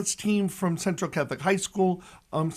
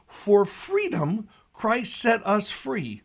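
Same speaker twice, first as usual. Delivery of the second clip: slow scripture quote; 3 semitones higher, slower than usual. Shown as a demonstration. Quoting scripture slowly, emphatically.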